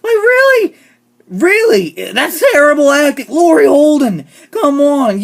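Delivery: loud, high-pitched disbelief; exasperated outburst. Real, not acted. Fan scolding an actress for terrible acting, loud and exasperated. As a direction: call out the actress, fed up and loud.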